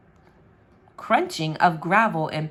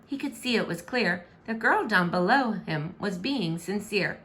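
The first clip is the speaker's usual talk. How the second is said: ordinary delivery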